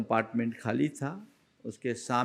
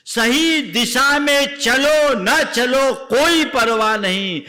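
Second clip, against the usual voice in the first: higher and louder, preaching at full force; a preacher rousing his listeners with a vow; fervent preaching; 11 semitones higher, 9 dB louder, slower than usual. Acted. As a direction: proclaim the vow to the crowd. Closing exhortation.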